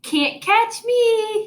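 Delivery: put-on high, slow, teasing toddler call; taunting holler. A narrator's reading. Teacher reading Fudge taunting his brother, high teasing call. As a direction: sing it out to tease. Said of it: "he hollered".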